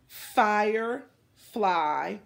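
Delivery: "Firefly" stretched very slow with a rising tune; drawn out word. Shown as a demonstration. Teacher modeling for the class, stretched slow and rising. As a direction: say "Firefly" slowly, syllable by syllable, rising at the end. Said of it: "Your turn".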